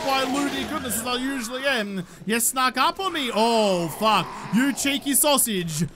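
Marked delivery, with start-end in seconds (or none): none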